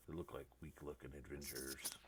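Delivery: low muttered aside from a brute; muttering. Acted. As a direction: mutter it under your breath. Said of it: "you hear him mutter under his breath".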